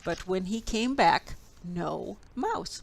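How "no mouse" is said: usual speaking voice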